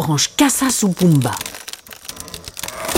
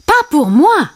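mild high-pitched character shout; high cry; 9 semitones higher, 4 dB louder than usual. A narrator's reading. Timon protests to Simba, mild high shout. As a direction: cry it out high, only a little louder. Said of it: "cria Timon".

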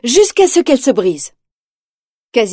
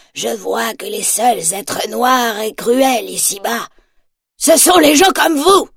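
defiant accusation; slower than usual. A narrator's reading. Quasimodo confronts Frollo.

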